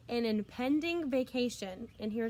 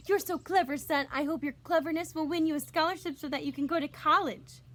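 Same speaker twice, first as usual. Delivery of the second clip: higher, louder proud mother voice; beaming mother; 5 semitones higher, 5 dB louder than usual. A narrator's reading. Mother praising her clever son, beaming high voice. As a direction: beam with pride at your son. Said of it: "his mother beamed at him".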